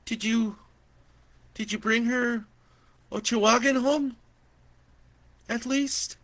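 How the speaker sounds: high, pleading, hesitant; pleading auntie. Acted. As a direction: ask haltingly, begging. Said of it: "asked with pleading eyes".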